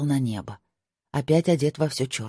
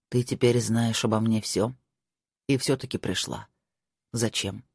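put-on hushed, lowered young lord; 4 semitones lower than usual. Marko voiced by narrator.

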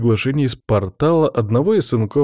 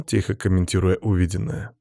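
plain reading voice

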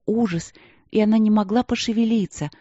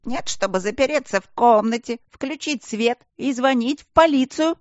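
snide; 7 semitones higher than usual. A narrator's reading. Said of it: "ехидно прокомментировал Аристарх".

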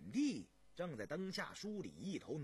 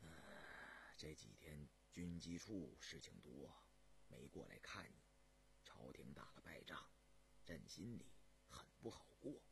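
very soft, low emperor's voice; deep, subdued, dispirited murmur; forced, weary cheer; 11 semitones lower, 14 dB quieter than usual. A narrator's reading. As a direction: confide heavily after a strained smile. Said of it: "雍正勉强笑了笑".

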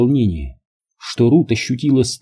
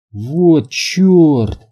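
higher, stunned exclamation; breathed-out shock; 5 semitones higher than usual. A narrator's reading. Alex seeing the cloud, exclaiming in shock. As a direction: let it out as a stunned oath. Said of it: "выдохнул Алекс".

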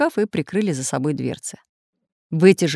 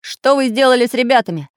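loud, higher-pitched demand; almost shouting; 6 semitones higher, 7 dB louder than usual. A narrator's reading. Craftsman demands news of the children, almost shouting. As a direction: raise it nearly to a shout. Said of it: "почти кричал Самоделкин".

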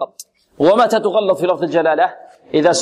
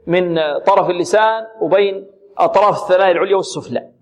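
usual speaking voice

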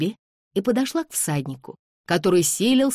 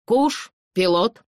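slow, higher-pitched order to a dog; firm command; 5 semitones higher, 4 dB louder, slower than usual. A narrator's reading. Master orders his dog to settle, firm command. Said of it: "после приказа хозяина".

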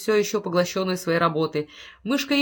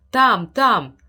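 frantic shout; 3 semitones higher, 8 dB louder than usual. Acted. Wife screams to husband to rush upstairs, loud panicked shout. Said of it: "закричала она".

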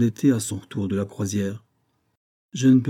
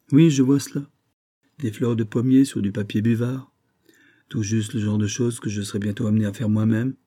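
ordinary delivery

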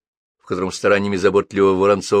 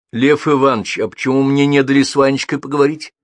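higher, louder aggrieved question; raised, sustained note of hurt; hurt, offended; 6 semitones higher, 6 dB louder than usual. A narrator's reading. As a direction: ask as if unfairly left out. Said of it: "обиженно спросила Елена".

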